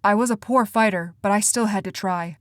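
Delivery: usual speaking voice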